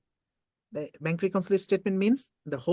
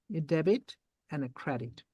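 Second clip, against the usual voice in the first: normal talking voice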